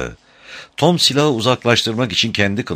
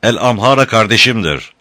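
raised, louder, emphatic answer; indignant retort; 5 semitones higher, 5 dB louder than usual. A narrator's reading. Tom answers the captor's insult about his brother, indignant retort. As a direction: throw it back firmly at the insult.

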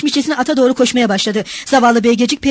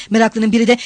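usual speaking voice

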